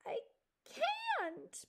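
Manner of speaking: high, drawn-out helpless cry. Acted. Mouse refusing to leave the log, crying out. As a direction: wail it high and slow. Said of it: "Poppy cried".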